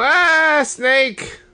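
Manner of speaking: high-pitched drawn-out mock yell. Imitating the badger chant's snake sighting, kinda yelling. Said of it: "But they're kinda yelling".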